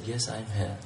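a soft, low voice from nowhere; quiet divine voice. Acted. God answering the hanging climber, softly. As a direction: answer gently, barely above a whisper. God's voice in story.

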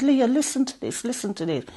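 imitated scream for help, kept restrained; mild retold scream. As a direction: retell calling out in alarm, pitch up, volume held back. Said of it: "so I started to scream".

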